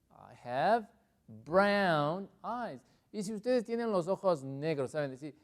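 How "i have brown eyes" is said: very slow, deliberate English; slow model answer. Shown as a demonstration. Teacher answering about eye colour, slow and deliberate. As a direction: space out each word clearly.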